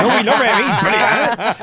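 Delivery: put-on a mate's broad Janner accent, laughing as it goes; hammed-up Janner accent. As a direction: overdo the Janner accent, stretching it slowly. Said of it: "in quite a thick Jano accent being like".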